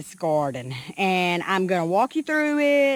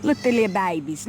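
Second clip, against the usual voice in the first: normal talking voice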